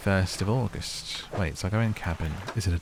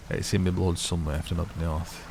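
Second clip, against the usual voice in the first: put-on northern accent. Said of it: "so many northern accents".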